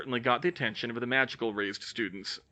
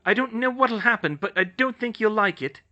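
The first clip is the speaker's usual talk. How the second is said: a raised, threatening heroine voice; threatening Hermione; 10 semitones higher, 6 dB louder than usual. Acted. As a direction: warn the ghost off, high and loud.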